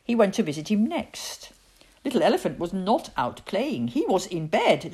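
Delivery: ordinary delivery, nothing marked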